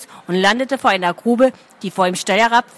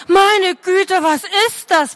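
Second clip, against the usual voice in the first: shout; 9 semitones higher, slower than usual. A narrator's reading. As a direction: bellow in shock. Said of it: "brüllte er".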